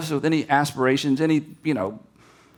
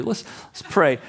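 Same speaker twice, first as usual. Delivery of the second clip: ordinary talk, nothing marked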